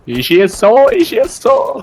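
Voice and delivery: high rising excited yell. Real, not acted. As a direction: shout it out while firing. Gamer yelling while shooting.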